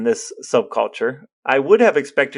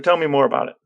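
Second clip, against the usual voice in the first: usual speaking voice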